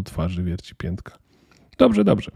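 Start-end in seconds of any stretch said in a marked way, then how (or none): 1.8-2.3 high-pitched, placating agreement; conciliatory. A narrator's reading. Girl backing down at the elf's expression, conciliatory. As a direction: give in, voice lifted high. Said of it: "dodała ugodowa".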